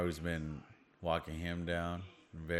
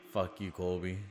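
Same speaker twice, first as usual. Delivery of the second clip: ordinary talk, nothing marked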